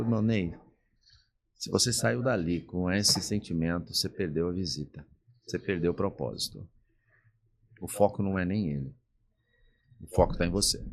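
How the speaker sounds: a pastor gently setting him straight. Retold or imitated. Pastor correcting an upset church member, calm and quiet.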